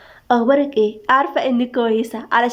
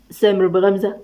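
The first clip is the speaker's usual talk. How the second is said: plain reading voice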